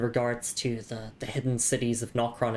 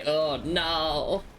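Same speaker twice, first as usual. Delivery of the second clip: high, hammed-up pleading; mock horror; 6 semitones higher, much slower than usual. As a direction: beg dramatically in fake terror. Mock horror at chat joke.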